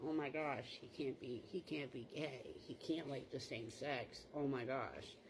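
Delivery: worried parents. Retold or imitated. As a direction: lightly imitate parents fretting under their breath.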